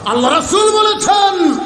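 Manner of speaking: high, ringing preacher's call; ringing proclamation. Real, not acted. Preacher announcing the Prophet's words, proclaimed high. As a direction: proclaim it high to the whole gathering.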